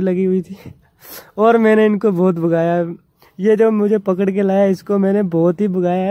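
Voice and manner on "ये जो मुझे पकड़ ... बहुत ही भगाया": ordinary talk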